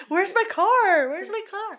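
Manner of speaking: high, drawn-out mock plea; mock bewildered moviegoer. Audience expecting a funny movie, mock bewildered. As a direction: wail it slowly like a lost fan.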